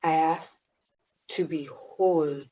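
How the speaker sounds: hushed whispered prayer; soft prayer. Acted. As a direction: pray softly to the universe. Leads a prayer.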